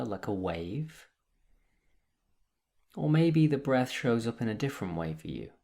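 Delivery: calm, measured meditation guide with long pauses; guided meditation. Acted. Meditation teacher guiding listeners through breath and body, calm and unhurried. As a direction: lead them gently into stillness, leaving space between phrases.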